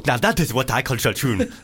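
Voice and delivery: hammed-up African accent; exaggerated South African accent gone astray. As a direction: overdo a put-on African accent. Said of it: "I'm going full that".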